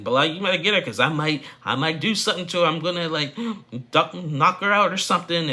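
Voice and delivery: high, loud imitated threatening yell; retold threat. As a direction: threaten her at the top of your voice. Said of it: "Shahida even threatened her".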